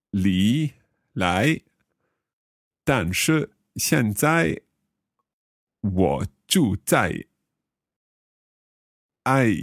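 slow, halting Chinese with long pauses; slow learner attempt. Shown as a demonstration. Learner slowly trying the sentence in Chinese. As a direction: feel your way through each syllable.

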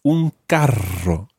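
slow "Un carro" with a long trilled R; over-rolled R. Shown as a demonstration. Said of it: "rolling your R's".

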